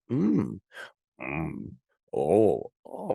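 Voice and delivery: imitated listening noises. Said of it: "I'm just going like".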